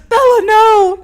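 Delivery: melodramatic, drawn-out mock wail; Edward Cullen. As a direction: overplay a tragic vampire hero's cry. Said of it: "my Edward Cullen moment".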